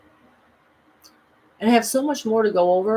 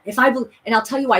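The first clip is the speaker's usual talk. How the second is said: ordinary delivery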